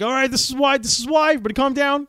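mock manager yelling calm down; manager calming crowd. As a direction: shout over the crowd to settle them. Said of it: "He's like".

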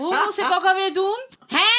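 high, loud, fast and flustered; imitating confused mom. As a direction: ham up a helpless mother with a laugh. Mimicking a confused parent.

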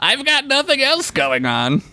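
put-on very high comic old-timer voice; a hammed-up, high-pitched codger; Samuel Clemens. Clemens explaining why he keeps showing up, high mock voice. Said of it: "Clemens from now on".

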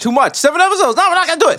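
squeaky exaggerated protest voice; high, ironic refusal; high mock protest. Mock outrage echo.

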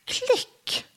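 a sharp high imitated door click; mimicked click. Reader voicing the door shutting, a high mouth click. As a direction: click it out like the lock snapping shut.

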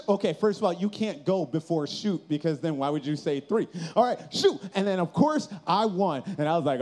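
ordinary talk, nothing marked

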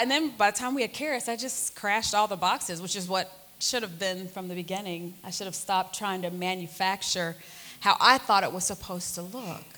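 ordinary delivery

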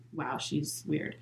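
slow, hushed judging remark; judgmental aside. Retold or imitated. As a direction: imitate someone judging, softly and slowly. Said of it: "you can tell they're judging you".

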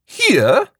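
high, breathless rising question; gasped. A narrator's reading. Shopkeeper gasping as she rushes to the window. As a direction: gasp in surprise. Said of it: "Missus Perkins gasped".

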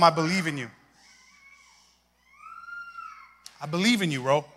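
soft, gentle reassurance; tender. Acted. Speaking to his younger self in a photo, soft and tender. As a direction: tell him softly you believe in him. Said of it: "tender words to younger self".

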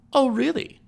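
very high, lilting questions; affected surprise. A narrator's reading. Hostess gossiping to a guest, in high-pitched surprise. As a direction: exclaim in a high, incredulous voice.

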